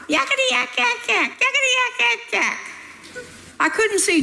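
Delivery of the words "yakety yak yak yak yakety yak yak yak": high, squeaky demon chatter. A narrator's reading. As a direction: squeal it like a taunting little imp.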